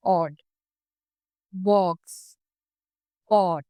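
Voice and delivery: ऑड, बॉक्स, पॉट with slightly rounded lips on the o; teacher modelling the rounded o; rounded short o. Shown as a demonstration. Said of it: "lips हैं वो थोड़े rounded होते हैं".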